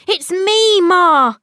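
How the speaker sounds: a high, loud call from the floor. Acted. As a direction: make Ma notice him. Calling up to unseeing mother.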